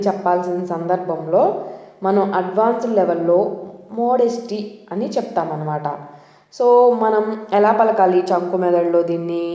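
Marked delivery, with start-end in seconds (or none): none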